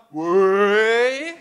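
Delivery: ordinary delivery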